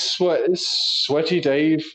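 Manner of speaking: drawn-out slow naming of Sweaty Dave; slow nickname. Real, not acted. Introducing a mate's nickname to the listener, slowly. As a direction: stretch the name out slowly.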